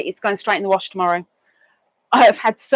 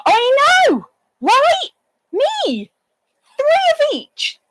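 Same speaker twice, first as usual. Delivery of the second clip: much higher, squeaky proud voice; high squeak; 3 dB louder, slower than usual. Real, not acted. Squeaking a boast about her sweets to the chat.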